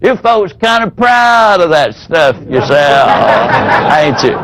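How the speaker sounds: a hint of a down-home Southern fella teasing; lightly voiced country fella, chuckling; good old boy drawl. Salesman imitating a down-home customer, lightly Southern. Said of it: "good old boy down home type fella".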